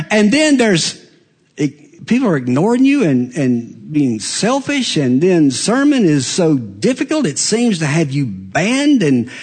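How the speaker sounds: ordinary talk, nothing marked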